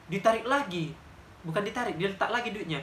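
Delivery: ordinary talk, nothing marked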